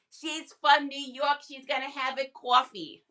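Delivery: amateur New York accent, drawn-out vowels; attempted New York accent. Shown as a demonstration. Streamer testing a Jersey-style accent for her viewers, attempted New York accent. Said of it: "your attempt to have the accent".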